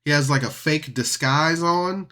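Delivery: ordinary talk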